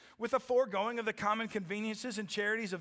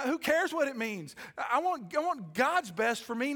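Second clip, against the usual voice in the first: high, loud revival preaching; full-force preaching; 6 semitones higher, 4 dB louder than usual. Acted. Pastor urging the church to surrender, at full preaching force. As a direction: fire up the congregation.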